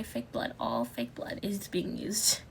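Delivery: whispering. Real, not acted. Repeat of warning whispered.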